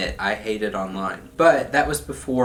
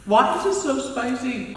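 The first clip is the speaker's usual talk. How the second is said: squeaky spicy complaint. Real, not acted. Reacting to spicy food.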